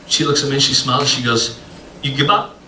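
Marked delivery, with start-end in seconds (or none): 2.0-2.5 lightly imitated Spanish-sounding accent, "give up" run into "gibop"; waitress's accent. Waitress asks a baffled diner, lightly accented. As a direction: mimic an accented "You give up" as "yugibop". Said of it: "I don't speak Spanish".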